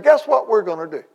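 spring the news with glee; suddenly cheered-up announcement; imitating happy pastor. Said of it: "And then he got happy".

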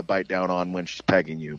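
ordinary delivery

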